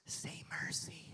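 whispered demand; imitated menacing whisper. Drunk tackler to the man in his chokehold, whispered.

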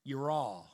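Yirah said as YEE-rah, drawn a touch like Southern speech; Hebrew word. Shown as a demonstration. Pastor teaching the Hebrew word, Yirah with a Southern lilt. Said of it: "that almost sound Southern".

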